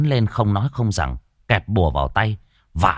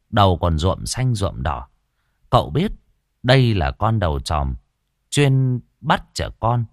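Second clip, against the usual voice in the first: ordinary delivery, nothing marked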